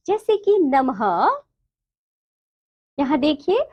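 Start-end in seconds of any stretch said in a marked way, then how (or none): 0.6-1.0 नमः with a light "ha" at the end; visarga pronunciation. Shown as a demonstration. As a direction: let नमः finish on a lightly sounded h. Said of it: "इसका जो उच्चारण होता है हल्का हो की तरह".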